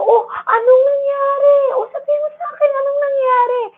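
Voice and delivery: high, anxious concerned mommy voice; worried parent. Shown as a demonstration. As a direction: play the alarmed parent asking what happened. Parent acting concern to child.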